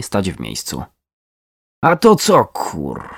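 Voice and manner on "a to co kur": high burst that drops away; pitched-up startled outburst; sudden exclamation. A narrator's reading. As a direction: cry out in sudden alarm, cut short. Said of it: "wykrzyknęła nagle".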